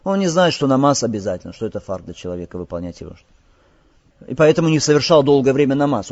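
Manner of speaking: ordinary talk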